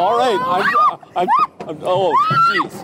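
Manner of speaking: trying to hold it together, failing; nervous squeak. Real, not acted. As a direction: voice jumping high with nerves. Frightened on the ride.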